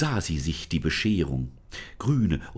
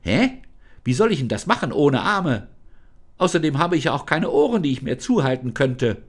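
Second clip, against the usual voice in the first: put-on high, quick, incredulous girl's voice; girl Lisa, baffled; 6 semitones higher, 6 dB louder than usual. Lisa objects to the spirit that she has no ears, high and baffled. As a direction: protest with high puzzled disbelief.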